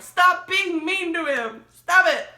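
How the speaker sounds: high, loud mock yell, slowed down; retold scream at characters. Reader retelling yelling at fictional characters, mock outrage. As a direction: yell at the book like the characters can hear. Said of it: "screaming at the characters".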